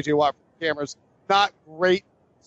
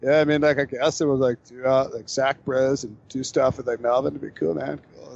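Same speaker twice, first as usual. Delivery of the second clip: surfer dude voice; 5 semitones lower than usual. Retold or imitated. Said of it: "but he sounds like a surfer".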